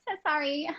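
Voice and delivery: "So sorry" sung out softly, lilting; musical apology. Shown as a demonstration. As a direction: make "So sorry" a soft little tune. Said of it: "it gets very musical".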